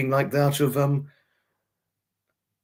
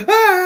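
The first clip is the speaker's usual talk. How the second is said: mock attack yelp; 4 dB louder than usual. Playing out a dog attack, mock yelp and snarling. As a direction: cry out as if dogs pounce, hammed-up. Acts out being attacked by dogs.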